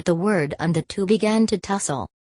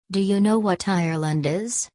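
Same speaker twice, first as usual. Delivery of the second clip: plain reading voice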